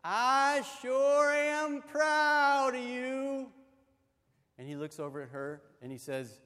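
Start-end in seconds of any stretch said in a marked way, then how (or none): 0.0-3.5 raised voice, drawn out slowly; higher, louder, stretched-out words; louder loving repeat. Acted. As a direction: say it slow and loud for a deaf husband. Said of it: "lovingly leans in a little closer".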